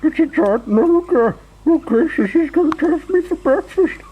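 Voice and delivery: very high, squealing panic; Mister Jelly screaming. A narrator's reading. Mister Jelly takes the tramp for a giant ogre, screaming. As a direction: wail in terror, pitched right up. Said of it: "he screamed".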